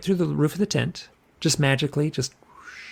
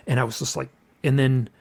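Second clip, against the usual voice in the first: ordinary talk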